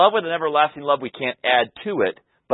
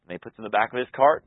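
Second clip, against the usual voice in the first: usual speaking voice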